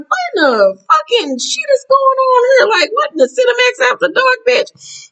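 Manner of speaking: very high, laughing disbelief; shocked outburst. Retold or imitated. Vlogger reacting to the flirty banter, laughing shock. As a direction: squeal it through laughter.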